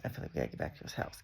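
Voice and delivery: fast, whispered inner panic; rushed whisper. Retold or imitated. His tripping mind urging a return home, fast whisper. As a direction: hurry it out under your breath.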